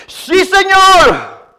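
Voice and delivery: high, long-stretched yes; eager climber. Retold or imitated. Climber assuring the Lord he believes him, eager reply. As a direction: answer up high, stretching each word.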